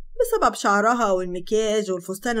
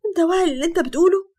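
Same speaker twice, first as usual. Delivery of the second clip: high, fast, loud tearful question; tearful disbelief; 7 semitones higher, 5 dB louder, faster than usual. Acted. Sara to Hatem, tearful and stunned. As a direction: plead through tears. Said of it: "بصت له وهي بتبكي وقالت".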